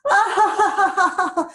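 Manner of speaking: a light high giggle at yourself; falling giggle. Shown as a demonstration. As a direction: laugh off the mistake. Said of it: "laugh at yourself".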